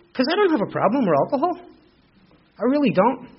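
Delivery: high, loud protest of innocence; hammed-up self-justifying denial; mock insistent denial. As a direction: protest too much, pitched right up. Mimicking his own denial at the bar.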